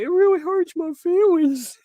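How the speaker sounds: high, loud fake-wounded whine; badly faked hurt feelings; mock hurt. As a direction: pretend to blubber way up high. Sarcastic mock hurt at critics.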